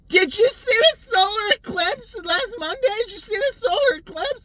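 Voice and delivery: very high, loud, slow put-on voice; mock chatty woman. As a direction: ham up the eager question. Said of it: "she's like".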